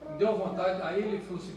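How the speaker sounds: ordinary talk, nothing marked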